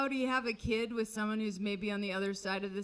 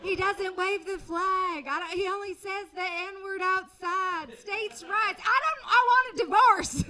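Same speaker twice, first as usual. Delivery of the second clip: higher, breezy Southern wife justifying her husband; Georgia wife; 6 semitones higher than usual. Retold or imitated. Comic imagining a Civil War wife talking about her husband, breezy then exploding. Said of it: "a wife in Georgia who's like".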